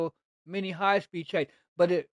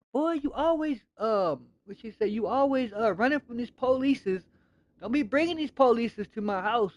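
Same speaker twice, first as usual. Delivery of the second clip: much higher, mock grandma scolding her grandson; nagging grandma; 6 semitones higher than usual. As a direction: wag the finger like a fed-up grandmother. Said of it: "She would say".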